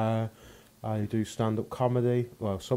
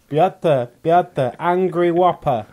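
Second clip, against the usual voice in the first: loud high calling out, names stretched; retold shout; 7 semitones higher, 10 dB louder, much slower than usual. Comedian reenacts shouting his order across a burger shop, loud mock call. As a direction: call across a fast food counter. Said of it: "is to shout across a fast food shop".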